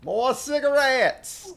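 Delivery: excited. Real, not acted. Finds more cigarettes in game.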